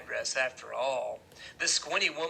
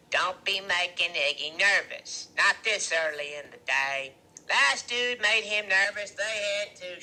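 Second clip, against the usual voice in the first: loud, slow woman character's voice; Loretta's warning; 4 semitones higher, 7 dB louder than usual. A narrator's reading. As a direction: warn them slowly and loudly. Loretta warns the boys.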